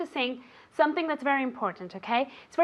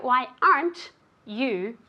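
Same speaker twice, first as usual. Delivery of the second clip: slow, spaced-out "Why aren't you"; slow contraction demo; slower than usual. Shown as a demonstration. Teacher showing students where the contraction goes, slow and deliberate. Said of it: "putting the contraction at the beginning".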